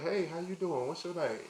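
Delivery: role-played come-on to a stranger; playful pickup line. Plays approaching her in a store.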